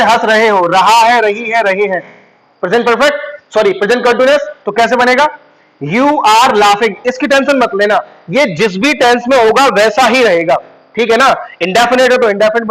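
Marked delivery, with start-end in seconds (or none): 5.8-6.9 very slow, drawn-out English words; slow teacher's model sentence; slow dictation. Shown as a demonstration. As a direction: spell out the translation word by word. Teacher dictates English translation slowly.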